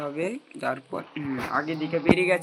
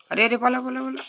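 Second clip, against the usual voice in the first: panicked run; 9 semitones higher, 4 dB louder, faster than usual. Real, not acted. Sudden danger, telling himself to run.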